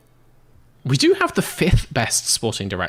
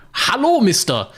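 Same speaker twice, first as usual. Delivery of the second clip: put-on German accent. Reading the team email to chat in a mock German accent. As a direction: read the email like a stiff German engineer. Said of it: "More German accent".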